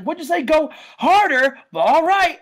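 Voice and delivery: high, loud, gleeful mishearing; taunting attacker. Acted. Wrestler mishears dad's plea, loud mocking taunt. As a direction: pretend you heard the opposite. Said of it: "And he's like".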